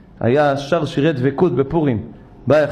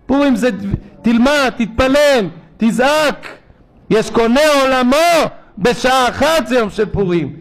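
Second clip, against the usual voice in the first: high, loud, impassioned exhortation; fiery preaching; 9 semitones higher, 3 dB louder than usual. Real, not acted. Rabbi exhorting the congregation on Purim, loud and fervent. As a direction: rouse the congregation to pray.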